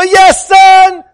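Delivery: loud, high hopeful reply; eager father. Retold or imitated. Father answering his son's call, loud and eager. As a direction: answer as if expecting a conversion. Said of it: "And I go".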